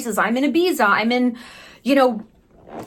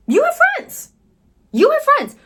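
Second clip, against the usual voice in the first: very high, squeaked reassurance; squeaky insistence; 5 dB louder than usual. Pretend. Vlogger insisting viewer is liked, squeaky. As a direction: squeal it to hammer it home.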